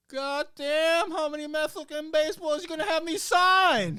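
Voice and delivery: a loud, high, exasperated roar; Mickey Mantle ribbing the bat boy; mock gruff shout. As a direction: bellow it like a grumpy star teasing. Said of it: "Mickey turns".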